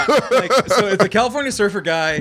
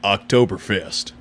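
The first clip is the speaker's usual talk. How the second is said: low, slow ad announcer; deep, drawn-out Hemi voice; truck commercial voice; 9 semitones lower, slower than usual. Retold or imitated. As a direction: drop way down like a Ram truck ad. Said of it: "I was doing the Hemi voice".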